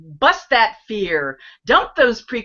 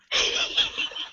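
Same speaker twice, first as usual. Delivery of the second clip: silent laugh; 9 dB quieter than usual. Shown as a demonstration. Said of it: "silent laughter".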